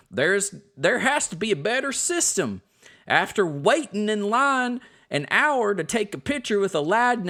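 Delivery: put-on playful Southern accent, reading a complaint aloud. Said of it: "They're all gonna have a Southern accent".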